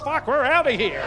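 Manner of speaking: lightly voiced hasty exit; a hint of a panicked getaway; mock escape. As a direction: blurt it like bolting out the door. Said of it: "they thought".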